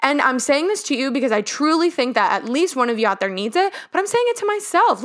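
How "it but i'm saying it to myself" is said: real emotion catching in the throat; getting emotional. Real, not acted. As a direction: voice rising high as emotion breaks through. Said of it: "I'm getting a little emotional".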